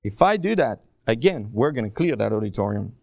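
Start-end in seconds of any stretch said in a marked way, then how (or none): none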